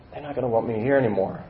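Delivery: normal talking voice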